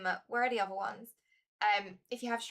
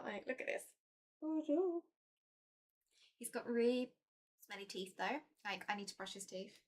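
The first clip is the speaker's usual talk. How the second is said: much softer, murmured; soft cat talk; 7 dB quieter than usual. Real, not acted. Owner murmurs to viewers about her cat's teeth, softly. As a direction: croon fondly near the cat.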